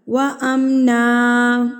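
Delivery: وَأَمْنًا ending on a long "a", not "an"; pause with alif. Shown as a demonstration. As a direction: stop on وَأَمْنًا as "wa-amnā". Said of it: "وقف کی صورت میں ایک الف". (وقف پر ایک الف، وَأَمْنَا)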